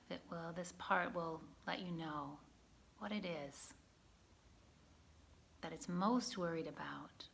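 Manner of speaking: gentle guided-practice voice with long pauses; guided meditation. Acted. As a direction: guide a listener calmly inward, leaving space between phrases.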